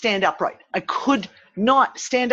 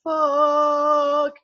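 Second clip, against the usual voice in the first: high, long drawn-out swear; shocked; 8 semitones higher, much slower than usual. Retold or imitated. Joker suddenly hit by the vertigo, high drawn-out shock. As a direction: stretch the last word in mock shock. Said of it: "to, What the fuck".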